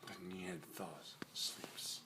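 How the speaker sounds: hushed nature documentary whisper; whispering narrator. Acted. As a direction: whisper like a wildlife host.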